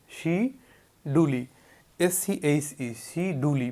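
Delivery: শিডুলি drawn out slowly, each syllable apart; teacher slowly spells out শিডুলি; slow syllable-by-syllable spelling word. Shown as a demonstration. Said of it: "দেখুন এই".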